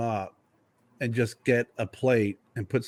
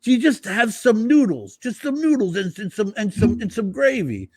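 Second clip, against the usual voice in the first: high mock-incredulous mobster voice; Paul mocking; 11 semitones higher, 4 dB louder than usual. Paul in the Sopranos mocking the New Jersey guys, high and scornful. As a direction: sneer up high at the noodles. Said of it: "Paul, he's like".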